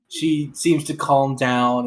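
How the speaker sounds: ordinary delivery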